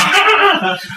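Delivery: high mocking laugh; imitated crowd laughter. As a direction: burst out laughing like onlookers. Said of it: "they're like".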